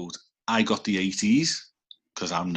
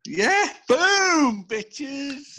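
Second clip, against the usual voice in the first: triumphant boast; much slower than usual. Real, not acted. Gloating over fast internet.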